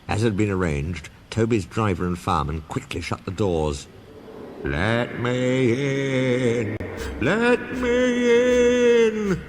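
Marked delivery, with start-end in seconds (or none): 4.6-9.4 very slow, high ghostly wail; spooky voice. A narrator's reading. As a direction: moan it like a ghost. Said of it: "said Percy in a spooky voice".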